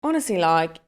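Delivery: put-on British reality-show islander accent; Love Island accent. Islander complaining in the villa, British Love Island accent. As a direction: play an affronted British Love Island contestant. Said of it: "hear your best Love Island accent".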